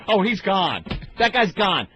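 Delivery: ordinary talk, nothing marked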